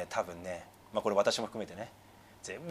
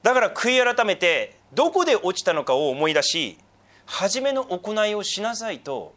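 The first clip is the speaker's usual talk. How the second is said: loud, slow exhortation with raised pitch; emphatic preaching; 6 semitones higher, 6 dB louder, slower than usual. Real, not acted. Pastor relaying Jesus' call to repent, emphatic preaching. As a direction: press the call home, drawing out the phrases.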